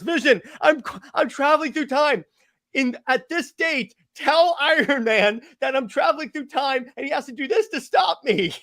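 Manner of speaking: high, strained mock-heroic plea; hammed-up time traveler calling out a desperate message; urgent hero message. Cap to Vision while traveling through time, urgently hammed-up.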